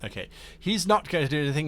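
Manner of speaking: normal talking voice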